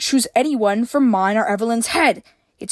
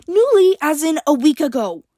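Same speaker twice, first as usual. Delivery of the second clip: hissed; 8 semitones higher, 5 dB louder than usual. A narrator's reading. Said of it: "April hissed".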